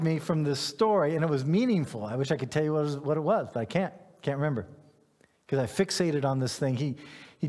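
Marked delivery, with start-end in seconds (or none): none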